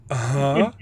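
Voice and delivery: lightly imitated drawn-out uh-huh; a rising, comic hint of an impression. Quoting the Nacho Libre clip, light comic impression.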